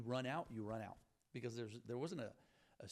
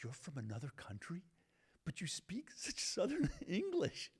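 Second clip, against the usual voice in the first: incredulous; 7 semitones higher, 5 dB louder than usual. Retold or imitated. Classmate learning he is foreign, incredulous. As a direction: imitate an astonished classmate, slowing at the end.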